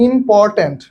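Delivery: "Important" slowly, as ইম-পর-ট্যান্ট; three separate syllables. Shown as a demonstration. Teacher counts out a three-syllable example for students, three separate syllables. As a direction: break "important" into three measured beats. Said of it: "তিনটা হয় ট্রাইসিলেবল".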